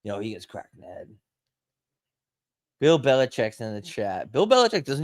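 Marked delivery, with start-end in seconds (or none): 0.0-1.1 a low, softer mumbling Boston local drifting off; mumbled Boston ramble. Retold or imitated. Friend mumbling a barroom story, softer and lower. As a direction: slur it under your breath like a barfly.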